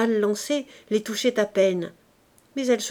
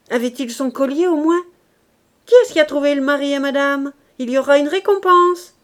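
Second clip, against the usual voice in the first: higher, louder sneering jeers; mock her so the whole laundry hears; loud mockery; 4 semitones higher, 6 dB louder than usual. A narrator's reading. Virginie jeering at Gervaise before the laughing crowd, loud and sneering.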